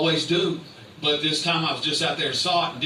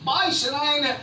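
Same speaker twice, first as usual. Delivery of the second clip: high mock outrage; 11 semitones higher than usual.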